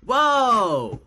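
high, drawn-out astonished reaction; hammed-up blown-away "whoa"; mock amazement. Retold Bostonian amazed at guess.

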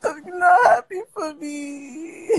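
mock sobbing, drawn out and high; fake crying. Whining to her friend on live, mock sobbing. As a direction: pretend to cry, hammed up. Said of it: "I'm about to cry now".

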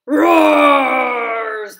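big roaring shout, slow; loud, slow lion-cub roar. Acted.

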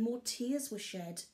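plain reading voice

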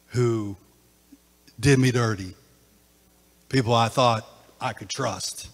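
hushed after-climax teaching; quiet reflective preaching. Acted. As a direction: bring them down gently.

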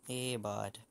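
lightly imitated Canadian accent. Gamer mimicking Canadians for teammates, light Canadian accent. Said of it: "Don't you love Canadians' accent?".

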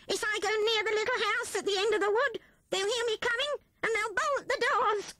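a put-upon rat fretting at speed; hungry Rat. Acted. Rat figure voiced.

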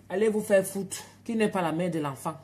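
put-on loud retort in an African French accent; angry African accent. Mother cursing the midwife in an African French accent. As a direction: tell her off, slipping into the African accent. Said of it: "Tu utilises l'accent africain".

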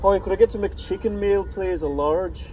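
put-on Irish accent, lively pitch. Shown as a demonstration. Vlogger ordering at the drive-thru for a laugh, put-on Irish accent. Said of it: "do this order in an Irish accent".

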